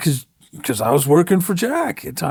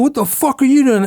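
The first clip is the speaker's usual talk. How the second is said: retold challenge, higher and a bit loud; older biker confronting a newcomer, angry; angry confrontation; 7 semitones higher, 4 dB louder than usual. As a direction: bark it at the young guy. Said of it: "goes right at me".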